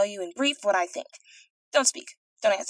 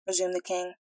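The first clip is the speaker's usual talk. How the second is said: ordinary delivery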